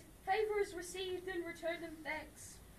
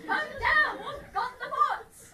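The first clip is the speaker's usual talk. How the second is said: excited call; 5 semitones higher, 9 dB louder, slower than usual. Acted. Announcing found gold triumphantly.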